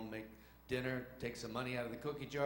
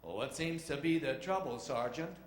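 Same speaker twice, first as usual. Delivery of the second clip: swaggering movie-hero impression, slightly raised; John Wayne; 3 semitones higher, 4 dB louder than usual. Captain to his sergeant on the bomber, hero impression. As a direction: play the unruffled leading man. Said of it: "John Wayne puts the plane".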